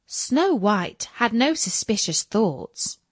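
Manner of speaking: ordinary delivery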